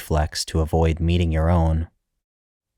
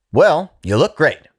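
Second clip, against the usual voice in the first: much higher, louder robotic cheer; robotic humorlessness; 11 semitones higher, 5 dB louder than usual. A narrator's reading. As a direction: chirp it like a machine. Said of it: "with robotic humorlessness".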